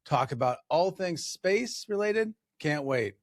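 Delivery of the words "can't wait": normal talking voice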